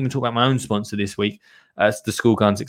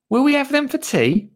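much higher, high-pitched eager question; Dottie's child voice; 13 semitones higher than usual. Acted. Dottie asks about the carrots, high-pitched child voice. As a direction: ask in a high child character voice. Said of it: "Asked Dottie".

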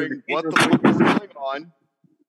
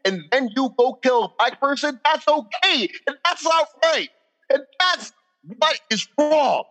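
rising anger; 6 semitones higher than usual. Real, not acted. Man venting to friends about police killings, growing angry. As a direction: let the outrage climb line by line. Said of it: "And I'm getting angry".

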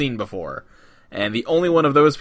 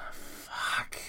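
whispered curse; 16 dB quieter than usual. Acted. Muttered curse after realising.

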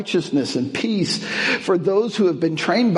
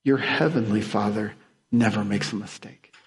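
normal talking voice

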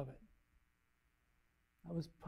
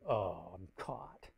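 low, slow and soft, caught out; a slow, soft, sheepish "oh, I'm caught"; 9 semitones lower, 8 dB quieter, much slower than usual. Retold or imitated. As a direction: mutter it like a guilty kid. Caught by the inner voice.

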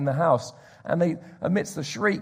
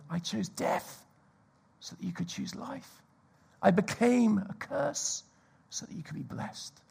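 hushed tender voice; 3 semitones higher, 6 dB quieter than usual. Acted. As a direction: lay it down gently, intimate. Voicing Jesus quoting verse.

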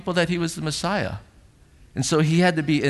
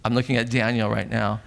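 ordinary talk

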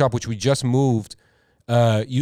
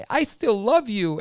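mock crying; 9 semitones higher, slower than usual. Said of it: "this guy's like".

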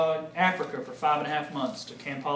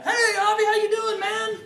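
much higher, laughing mentor voice; friendly Brad; 4 dB louder than usual. Retold or imitated. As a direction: do Brad's upbeat hello. Mentor Brad's greeting.

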